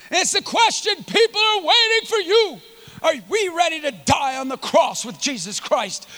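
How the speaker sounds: urgent sermon appeal; impassioned preaching. Real, not acted. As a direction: open high, then drive it home. Preaches at full force.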